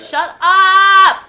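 loud, high angry shout. Real, not acted. Fed up with cohosts talking over her, loud angry shout. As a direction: blast them to shut up.

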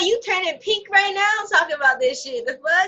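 high, loud indignant comeback; fired-up retort. Retold or imitated. Retold comeback to the Macy's shopper, loud and indignant. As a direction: shoot it back at the customer, offended. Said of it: "I'm like".

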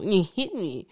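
sneering, taunting echo of her words; mocking. Abuser mocking her after hitting her, taunting echo. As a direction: mimic her cruelly, a mild mock taunt. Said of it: "that mocking thing of".

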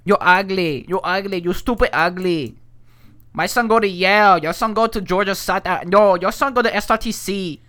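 high, loud broken-English brag; mock immigrant parent voice. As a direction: shout the boasts in a put-on accent. Put-on accent bragging parent.

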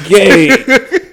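laughing hard mid-question; cracking up while talking. Real, not acted.

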